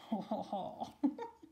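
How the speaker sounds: low, put-on chuckle; character laugh. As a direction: laugh as the cocky scarecrow. Reginald laughs after his invitation.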